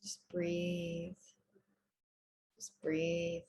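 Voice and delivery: soft, low-key breathing guidance; calm meditation voice with long, restful pauses; guided meditation. Real, not acted. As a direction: ease them into stillness, softly and unhurried.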